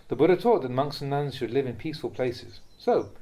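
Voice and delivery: usual speaking voice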